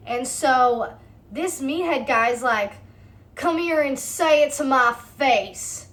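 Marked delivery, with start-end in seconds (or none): 3.4-5.8 slow, drawn-out challenge, imitated; mock threatening yell. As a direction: imitate a tough guy picking a fight. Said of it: "yelling insults at them now".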